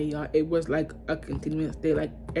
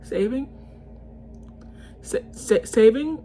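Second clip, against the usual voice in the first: incredulous echo; 5 semitones higher than usual. Real, not acted. Repeating his excuse to viewers, outraged disbelief. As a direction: spit the word back like you can't believe it.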